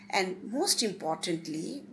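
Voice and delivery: ordinary talk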